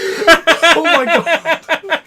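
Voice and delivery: drawn out, laughing high and loud; stretched, breathless laughing disbelief. Real, not acted. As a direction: gasp it out through real laughter. Laughing at mother's trick.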